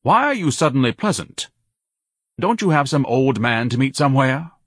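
high, pompous challenge; suspicious. A narrator's reading. Son challenging his mother's sudden kindness, suspicious. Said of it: "Ignatius asked suspiciously".

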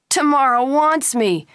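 high, loud and drawn out; excited boast. A narrator's reading. Boy boasts to his friend about the woman, excitedly. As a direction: crow it slowly, high and loud.

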